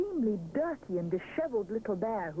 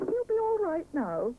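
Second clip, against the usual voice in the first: reassuring; 11 semitones higher, 5 dB louder than usual. A narrator's reading. Mister Sponge reassures.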